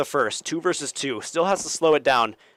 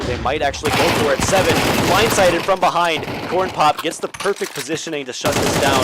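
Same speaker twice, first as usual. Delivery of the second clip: louder, higher hyped call; excited commentary; 4 semitones higher, 6 dB louder than usual. Real, not acted. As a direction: build with the play. Big play in round.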